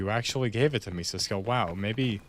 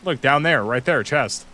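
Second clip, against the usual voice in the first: louder, eager call to a teammate; excited calling out; 4 semitones higher, 4 dB louder than usual. Real, not acted. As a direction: point it out with excitement. Spotting a chest, calling friend.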